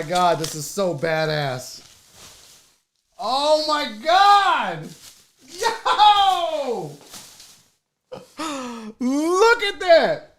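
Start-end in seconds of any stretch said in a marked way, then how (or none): 3.2-10.3 high, drawn-out amazed exclamations; awestruck. Real, not acted. Unboxer revealing a painting, awestruck. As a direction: gasp and marvel at it, high and slow. Marvelling as the gift appears.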